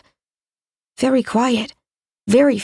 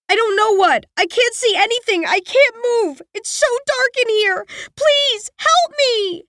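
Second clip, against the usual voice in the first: put-on high, pleading trapped girl rising in panic; desperate girl; 11 semitones higher than usual. A narrator's reading. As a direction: beg for help from the dark. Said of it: "not the desperation they carry".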